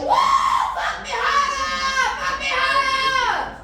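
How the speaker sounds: high, slow hammed-up moaning yell; mock moaning. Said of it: "she was like".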